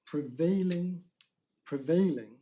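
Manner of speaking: "prevailing" said loud and set apart, twice; stress on PREVAILING. Real, not acted. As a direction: lean hard on each "prevailing", with a pause after. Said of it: "I emphasize prevailing".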